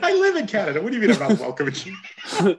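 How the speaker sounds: high, loud, laughing through the words; laughing disbelief. Real, not acted. Canadian host laughs at being told he's unwelcome. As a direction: laugh it off in mock protest.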